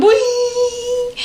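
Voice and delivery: squeaky high whee; high signal sound. Retold or imitated. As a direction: sing out like a magic beacon. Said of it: "it's like".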